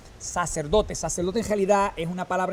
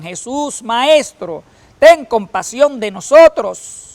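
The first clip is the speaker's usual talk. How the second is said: higher, louder plea, slowing at the end; lepers crying out; 6 semitones higher, 12 dB louder, slower than usual. Acted. Lepers shouting to Jesus from a distance, pleading. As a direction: call out for mercy from far away. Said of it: "a gritos le decían".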